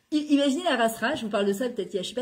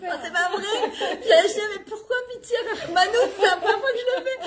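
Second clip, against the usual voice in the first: much higher, laughing hard; 10 semitones higher than usual. Real, not acted. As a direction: laugh through her mother's words. Mother quoted, laughing.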